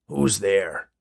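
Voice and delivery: a touch of alarm in the challenge; lightly voiced wary man calling into the dark; startled man. A narrator's reading.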